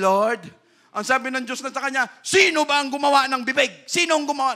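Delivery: loud, high pulpit voice; fiery preaching. Acted. Preacher rousing the brethren, loud and high. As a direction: drive the point home to the congregation. Preaching, acting Moses and God.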